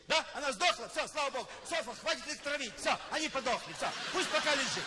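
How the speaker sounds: high, loud brash admin voice; brassy receptionist. Retold or imitated. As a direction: shrug it off like an Odessa clerk. Hotel admin answers phone.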